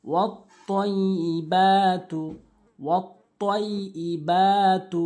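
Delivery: slow tajweed recitation, drawing out the long vowel in والطيبات. Shown as a demonstration. A teacher demonstrating slow tajweed to students. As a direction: stretch the a of والطيبات by one alif. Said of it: "অবশ্যই এক আলিফ টানবো".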